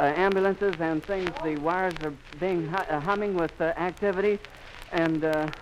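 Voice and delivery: ordinary talk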